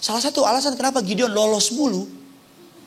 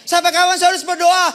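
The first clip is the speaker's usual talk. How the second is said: questioning congregant; 7 semitones higher, 4 dB louder than usual. Acted. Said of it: "Saya suka bilang dalam khotbah saya".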